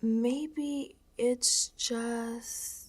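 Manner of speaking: surrender, drifting away; resigned whisper. Acted. Giving up, resigned.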